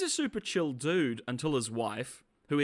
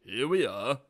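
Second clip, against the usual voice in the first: slow, lightly put-on resigned drawl; a mild deep resigned shrug of a voice; George Takei impression; 3 semitones lower, slower than usual. Imitating the character's resignation, slow and drawled. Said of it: "It's like a".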